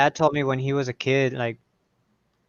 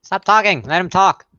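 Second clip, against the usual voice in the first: raised voice; 5 semitones higher, 5 dB louder than usual. Real, not acted. Scolding others talking over a speaker.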